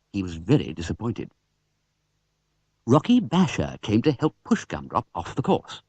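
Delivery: ordinary delivery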